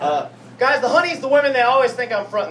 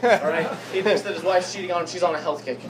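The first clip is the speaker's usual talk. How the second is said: ordinary delivery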